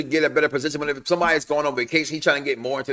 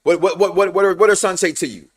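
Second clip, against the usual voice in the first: ordinary talk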